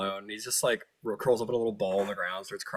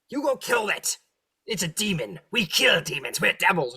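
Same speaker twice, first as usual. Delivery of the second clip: high goading devil voice; 7 semitones higher than usual. Acted. Devils goad Crickendalt.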